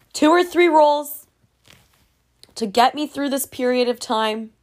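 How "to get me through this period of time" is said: ordinary talk, nothing marked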